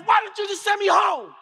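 put-on high-pitched angry kid yell. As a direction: shout it at the vice principal. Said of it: "So I look at her and I yell".

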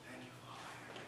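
breathy whispered thanks; whispered aside. Real, not acted. Preacher thanking the Father mid-sermon, whispered. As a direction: whisper it to God under your breath.

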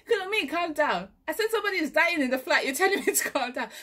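high, loud, fast exasperation; exasperated plea. Retold or imitated. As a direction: push back at her, frustrated. Arguing with auntie.

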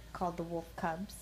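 ordinary delivery

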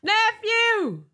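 high, delighted call falling at the end; thrilled high exclamation; excited cry. Real, not acted. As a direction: cry out to your new nephew. Greeting the new baby nephew.

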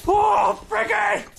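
very high cry to the dog; real excited high exclamation; over-excited squeal. Real, not acted. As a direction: squeal the dog's name with delight. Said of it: "I'm a little too excited".

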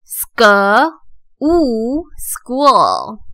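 "school" in parts: "sk", long "oo", then whole; sounding out. Shown as a demonstration. Teacher reviews school for learners, sounding out. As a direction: blend "sk" and "oo" into "school" with short gaps. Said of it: "再来复习一次".

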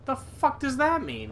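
high, exasperated demand; defensive outburst. Real, not acted. Player demanding his friend explain a nonsense phrase, defensive and high-pitched. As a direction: pitch it way up in baffled annoyance. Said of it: "Why are you getting so defensive?".

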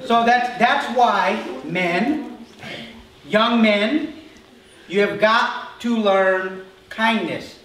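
forceful sermon; emphatic preaching. Real, not acted. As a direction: drive the point home to the men. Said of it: "preaching at full force".

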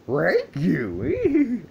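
high, loud cartoon dog voice; Scooby-Doo. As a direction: yelp it like a goofy talking dog. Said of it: "I can do Scooby-Doo's voice".

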